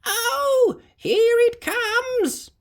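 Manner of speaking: put-on very high, slow old lady voice; delighted granny squeak; excited granny.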